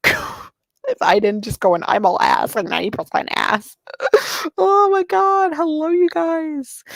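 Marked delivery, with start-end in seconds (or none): 3.9-4.5 high squealing giggle; a high, delighted squeal of a laugh. Real, not acted. Streamer squeals with laughter at chat's joke. 4.6-6.8 high, laughing hello; giggly greeting. Real, not acted. Streamer greets chat while still giggling high.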